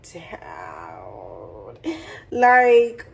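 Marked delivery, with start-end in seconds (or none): 0.1-1.8 breathy whispered down; hushed whispered word. Real, not acted. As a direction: drop to a whisper. Whispered emphasis.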